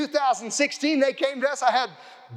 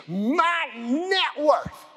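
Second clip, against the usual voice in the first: very slow, higher, savouring each word; stretched-out exclamation; 4 semitones higher, much slower than usual. Retold or imitated. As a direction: draw the words out for the crowd. Retelling his own excited shouting.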